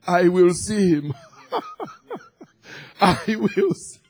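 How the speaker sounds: declaration breaking into laughter; joyful laughing. Real, not acted. Preacher rejoicing he will see God, laughing. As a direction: laugh through your certainty.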